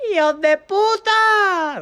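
very high, loud, drawn-out mock scream; outraged yell. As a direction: holler the insult in a long high shriek. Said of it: "y salté yo".

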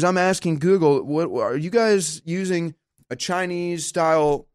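ordinary delivery

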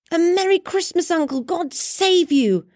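high, bright-hearted greeting; cheerful nephew. A narrator's reading. Nephew greets his uncle with a high cheerful call. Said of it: "cried a cheerful voice".

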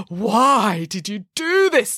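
demanding. Acted. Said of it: "demanded Anna".

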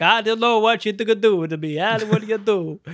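over-the-top fake Italian accent; exaggerated comic Italian imitation; Chico Marx. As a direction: garble it in a hammed-up mock-Italian voice. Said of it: "so over the top".

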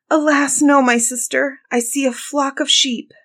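high-voiced, regretful reply; dismayed sister. A narrator's reading. Sister reporting from the tower, dismayed. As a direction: call down bad news in a high voice.

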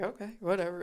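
quietly imitating a bored guy; indifferent shrug. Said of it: "and he was like".

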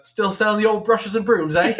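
hammed-up London accent, like an improv Englishman; bad London accent. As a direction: caricature Londoner calling out brightly to a street seller. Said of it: "pull out all the English stereotypes".

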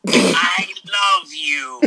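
slow drawn out toy phrase; pull string toy voice. Pretend. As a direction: stretch it like a talking doll. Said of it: "let's try it again".

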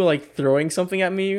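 ordinary delivery

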